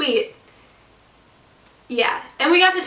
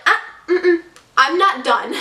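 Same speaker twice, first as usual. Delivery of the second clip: higher-pitched, hushing "ah-ah" then firm; mock scolding interruption; 6 semitones higher, 3 dB louder than usual. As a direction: imitate a bossy agent cutting someone off. Agent cuts the speaker off.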